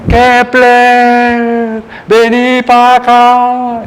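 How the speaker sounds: high, slow call across; loud slow hail for the friend. Retold or imitated. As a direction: shout it out drawn out and high. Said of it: "¿cómo lo llamó?".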